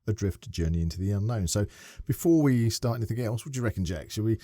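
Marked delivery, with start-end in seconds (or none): none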